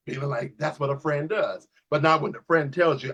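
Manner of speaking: usual speaking voice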